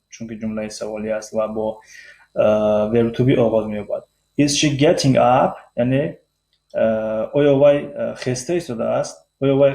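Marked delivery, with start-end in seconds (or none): 4.4-5.6 "Is she getting up" slow, climbing on "up"; rising question tune. Shown as a demonstration.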